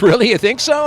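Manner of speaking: hammed-up delight at the praise; mock eager writer. Kit Carson hears Bill likes the script, high loud delight.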